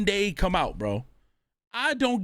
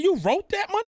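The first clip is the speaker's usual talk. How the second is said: much higher, stunned "you wrote that?"; retold disbelief; 12 semitones higher, 3 dB louder than usual. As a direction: replay your own shocked reaction, pitched way up. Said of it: "I said".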